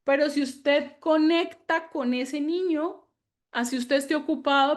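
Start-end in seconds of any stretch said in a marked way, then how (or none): none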